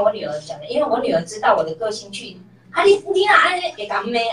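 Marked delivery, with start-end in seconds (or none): 2.7-4.3 higher, louder mock scolding in Taiwanese; angry scolding. Mom imitates her old angry self at the bakery, higher and louder. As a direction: snap at the clerk like the old hot-tempered self. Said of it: "就生气".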